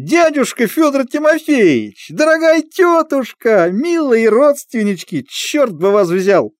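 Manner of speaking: high slow clowning voice; booming circus ringmaster. Acted. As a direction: ham it up for the audience. Clown greeting his animals onstage. (клоунский голос на публику)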